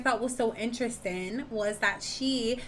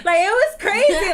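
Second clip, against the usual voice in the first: laughing; 9 semitones higher, 9 dB louder than usual. Real, not acted. Laughing at her own story.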